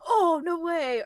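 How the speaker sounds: mock gasp of amazement, voice lifted; excited audience reaction. Retelling the crowd's reaction to friend, imitated excitement.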